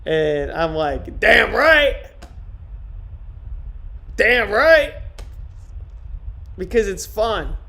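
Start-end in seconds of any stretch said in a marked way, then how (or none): 1.2-4.9 loud, raised-pitch punchy boast; emphatic agreement. Retold or imitated. Streamer answering praise for handling freaks, loud emphatic agreement. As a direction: proudly belt it out, higher and louder. Acting out own proud reply.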